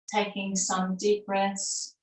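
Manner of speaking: unhurried, settled meditation cueing; guided meditation. Acted. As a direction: guide them gently into stillness. Leads a breathing meditation.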